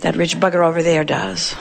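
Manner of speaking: low, dry grumble under the breath; resentful muttered aside. Acted. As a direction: mutter it sourly for laughs. Punchline grumble ending the mock complaint.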